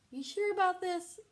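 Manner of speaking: high, loud skeptical question; unsure; a dubious friend; doubtful. Acted. Arthur doubtful.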